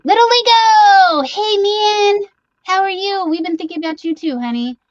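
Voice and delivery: high, bright squeal of hello; excited high greeting. Real, not acted. Greeting a viewer in chat with a high excited squeal. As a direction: squeal a welcome to a friend.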